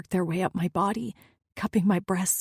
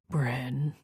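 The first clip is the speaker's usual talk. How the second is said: warning; 5 semitones lower than usual. Acted. Lover warning the woman who teases him. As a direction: say her name as a warning to stop teasing. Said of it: "he said my name like a warning".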